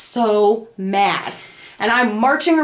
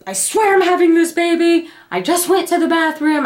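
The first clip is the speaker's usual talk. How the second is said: freaked out; 6 semitones higher than usual. Retold or imitated. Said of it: "I freaked out and I called".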